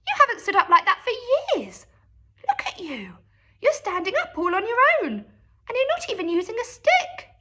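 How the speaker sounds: high, quick and amazed; excited boy. A narrator's reading. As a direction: rattle it off in wonder. George marvels at Grandma.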